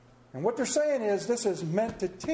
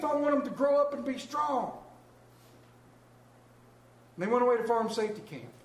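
ordinary talk

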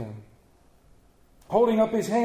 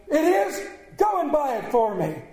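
excited order; 7 semitones higher, 3 dB louder than usual. A narrator's reading. Scrooge excitedly orders turkey.

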